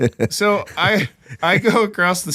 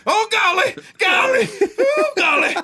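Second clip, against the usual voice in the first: higher, pained golly; straining moan; 13 semitones higher than usual. Retold or imitated. Man on the toilet, higher pained moaning. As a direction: groan it out mid-effort.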